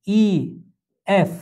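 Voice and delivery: usual speaking voice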